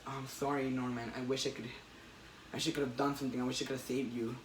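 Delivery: a quiet, sorry farewell; sad Peter. Retold or imitated. Retelling the comic, Peter apologizes to dying Norman, mildly sad.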